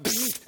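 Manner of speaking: high buzzing zap made with the mouth; quick vocal "bzzzt" for instant power; imitated zap sound. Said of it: "he could have gone".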